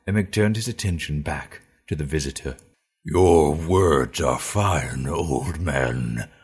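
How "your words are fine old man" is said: slow, lower, openly distrustful; suspicious orc. A narrator's reading. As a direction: let the suspicion show. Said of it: "not masking his suspicion".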